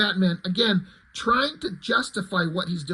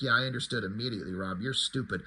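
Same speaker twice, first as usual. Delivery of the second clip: mock reader; 8 semitones lower, 8 dB quieter, faster than usual. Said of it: "read issue one and were like".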